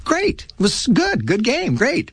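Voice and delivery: high, breezy praise; dad's praise. Retold or imitated. Dad praising his son after a good game, high breezy. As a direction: toss off easy praise like dad. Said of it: "my dad's like".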